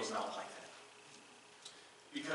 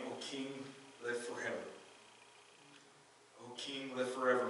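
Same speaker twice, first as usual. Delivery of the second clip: softer, hushed "O king, live forever"; soft reply; 8 dB quieter than usual. Acted. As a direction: answer gently from the lion's den. Daniel answers from the den.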